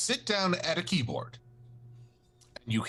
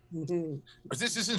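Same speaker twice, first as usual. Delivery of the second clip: laughing. Real, not acted. Laughing through the German bit.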